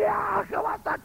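high, fast ecstatic cry; hammed-up fervour, overdone on purpose; mock emotional cry. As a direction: overwrought church outburst. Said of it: "meterle al acelerador a las emociones".

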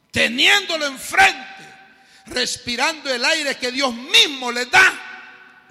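loud, slow, high preacher's cry; a pastor thundering from the pulpit; fiery preaching. Acted. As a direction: rebuke the congregation at full force. Preaching at full force.

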